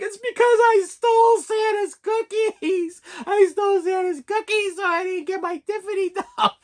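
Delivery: put-on very high, slow, sobbing little girl voice; a small child confessing through tears; imitating crying daughter. Daughter confessing to dad about Santa's cookies, high teary wail. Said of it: "She's like".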